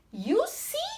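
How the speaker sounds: high, loud, rising "you see"; triumphant mouse. Acted. Mouse crowing to the Gruffalo that it was right, triumphant. Mouse proves its boast again.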